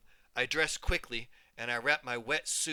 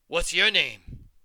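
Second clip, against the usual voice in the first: demanding; 4 semitones higher, 10 dB louder, slower than usual. Acted. Bully challenges at the pool.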